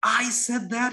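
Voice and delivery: high-pitched acted shock; incredulous. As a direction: protest in stunned disbelief as the king. King repeats his shocked question.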